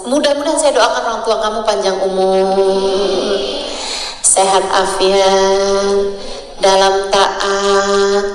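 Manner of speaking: drawn-out, half-sung well-wishing; slow, lilting supplication; prayerful blessing. Real, not acted. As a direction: stretch each phrase ending like a prayer. Offers a blessing for listeners' parents.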